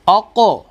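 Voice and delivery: अको with a thick, full-mouthed क and bounce; अको said thick with a qalqala echo; heavy qalqala. Shown as a demonstration. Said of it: "कलकला भी करना है और उसको मोटा भी करना है".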